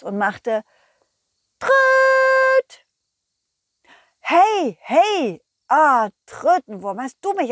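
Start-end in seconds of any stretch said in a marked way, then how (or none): 1.6-2.9 very high, loud trumpeting toot. Acted. Worm trumpets out of bush. 4.2-6.1 loud, higher startled cry; startled shout. Acted. Dog startled by worm.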